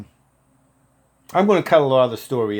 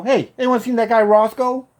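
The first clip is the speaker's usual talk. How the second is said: retold shout, high and loud; calling out; 11 semitones higher, 7 dB louder than usual. Patient looking around for his promised helper, calling out. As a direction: call across the room at raised pitch.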